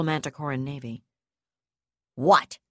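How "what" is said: loud, curt snap; snapped. A narrator's reading. Brigadier snaps at the enemy commodore. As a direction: hurl it at the screen. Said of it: "she snapped".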